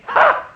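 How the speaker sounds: a very high, loud scoffing "Ha!"; incredulous laugh. Acted. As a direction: laugh off the whole idea. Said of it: "is as incredulousness".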